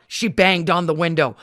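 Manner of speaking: ordinary delivery, nothing marked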